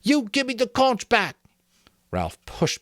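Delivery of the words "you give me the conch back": high, aggrieved demand; insist, hurt; demanding Piggy. A narrator's reading. Piggy to Jack over the conch, a high aggrieved demand.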